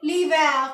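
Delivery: l'hiver with the h dropped, said clearly; French pronunciation. Shown as a demonstration. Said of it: "एच को pronounce नहीं किया". (l'hiver में एच न बोलें)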